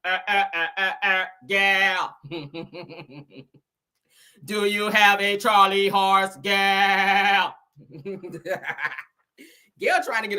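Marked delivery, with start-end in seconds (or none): 0.0-2.2 slow, flat robot voice talking aggressively; robotic Martian. Retold or imitated. Host mocking a Martian robot voice, slow and robotic. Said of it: "Real robotic and shit". 4.4-7.6 slow, flat robot voice talking aggressively; robotic Martian. Retold or imitated. Host mocking a Martian robot voice, slow and robotic. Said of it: "Real robotic and shit".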